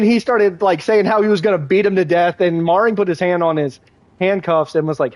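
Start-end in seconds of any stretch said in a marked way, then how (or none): none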